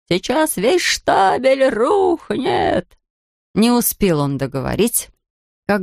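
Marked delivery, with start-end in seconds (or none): 0.1-3.0 much higher, long drawn-out shout; yelled warning. A narrator's reading. Foreman warns the crowd, high drawn-out yell. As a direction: bellow the warning. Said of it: "заорал мастер".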